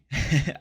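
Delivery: nervous laugh at his losses; short burst of laughter. Real, not acted. Investor laughing at his big monthly loss, rueful laugh.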